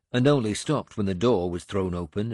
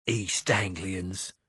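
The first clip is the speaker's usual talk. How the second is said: angry; much slower than usual. A narrator's reading. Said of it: "Radwal said angrily".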